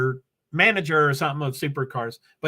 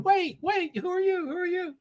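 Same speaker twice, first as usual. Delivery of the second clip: much higher, puzzled "who are you?"; Spider-Man meme voice; 13 semitones higher, 4 dB quieter than usual. Retold or imitated. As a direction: play the pointing Spider-Man, baffled. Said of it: "Spider-Man pointing at each other going".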